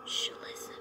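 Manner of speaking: hushed whisper. Real, not acted. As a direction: whisper so the game can't hear. Hushing while listening for sounds.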